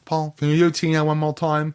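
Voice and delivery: playful, poorly faked New Zealand accent; horrible New Zealand accent. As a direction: imitate a Kiwi bandleader badly. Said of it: "With your horrible New Zealand accent".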